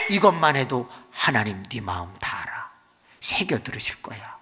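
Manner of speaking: ordinary talk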